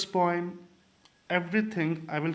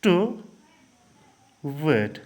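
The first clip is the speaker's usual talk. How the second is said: word by word; 3 semitones lower, slower than usual. Shown as a demonstration. Teacher reading the second line to count syllables, word by word. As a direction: separate the words with a clear pause. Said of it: "दूसरी वाली line पे हम जाए".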